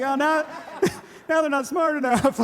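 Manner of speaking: high, loud mock alarm through laughter; laughing mock worry. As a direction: crack up while imitating a fretting parent. Acts worried parent, laughing.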